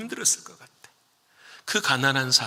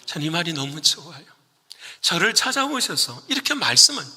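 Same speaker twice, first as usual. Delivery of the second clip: normal talking voice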